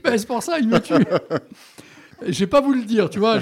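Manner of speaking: amused, giggling while admitting it; laughing. Real, not acted. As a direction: talk through real laughter. Host laughing at the joke.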